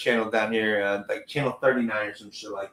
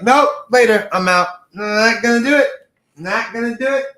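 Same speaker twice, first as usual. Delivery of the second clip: higher, louder emphatic brush-off; mock refusal; 9 semitones higher, 7 dB louder than usual. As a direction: bail out in a high, punchy mock quote. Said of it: "Jay-Z was like".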